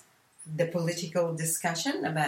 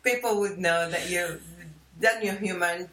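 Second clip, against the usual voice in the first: laughing; 3 dB louder than usual. Real, not acted. Laughing at her own joke.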